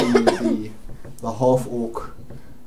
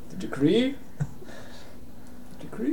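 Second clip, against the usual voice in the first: higher, rising, hopeful query; bewildered question; 11 semitones higher, 6 dB quieter, faster than usual. Acted. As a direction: ask it small and puzzled, without shouting. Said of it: "He looks at you".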